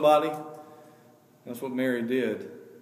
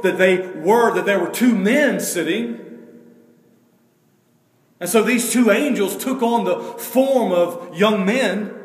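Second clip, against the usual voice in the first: loud, high sermon voice, slowing at the end; preaching hard from the pulpit; full-force preaching; 6 semitones higher, 8 dB louder than usual. Acted. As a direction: build it up for the congregation.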